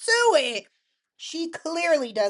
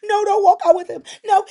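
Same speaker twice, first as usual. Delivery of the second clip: higher and louder, begging her to stay; desperate plea; 9 semitones higher, 11 dB louder, faster than usual. Retold or imitated. Will begging Elektra not to leave, loud and desperate. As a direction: call after her as she leaves. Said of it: "He's like".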